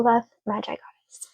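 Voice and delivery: breathy whispered signature; a hushed, intimate sign-off. Real, not acted. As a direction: whisper your name softly as a sign-off. Signing off the reading.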